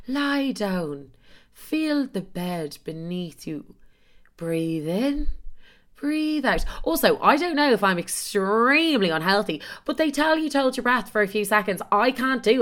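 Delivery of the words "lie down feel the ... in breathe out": soft, slow, hushed meditation guide; imitating the app's soothing guide slowly and softly; mock meditation voice. Mocking her meditation app, slow hushed guide voice. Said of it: "it's literally like".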